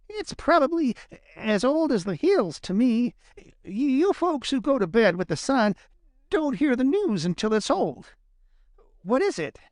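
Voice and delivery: high-pitched, scornful rabbit; dismissive Peter Rabbit. A narrator's reading. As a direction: brush off the news in a high voice.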